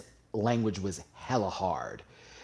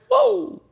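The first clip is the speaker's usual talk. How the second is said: short high, loud "whoa"; sudden whoop of delight; swooning whoop; 7 dB louder than usual. Real, not acted. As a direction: yelp it high, overcome. Swooning over island accents.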